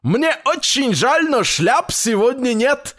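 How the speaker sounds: very high-pitched shopkeeper; high rabbit voice. A narrator's reading. Rabbit peddler turns grandfather away, high rabbit voice. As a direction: apologise in a squeaky salesman's voice.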